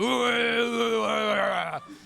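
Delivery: long, high-pitched mock growl; playful hugging roar. Comedian imitates dad hugging him, playful roaring. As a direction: imitate a dad's wordless bear-hug roar. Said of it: "like a fucking, "Oh, I'm gonna get ya!".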